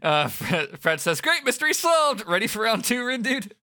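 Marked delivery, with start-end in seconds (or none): 1.2-3.5 high-pitched cheerful sidekick; high character voice. Acted. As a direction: pitch it way up, bright and eager. Voicing Fret, much higher.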